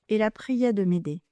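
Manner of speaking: plain reading voice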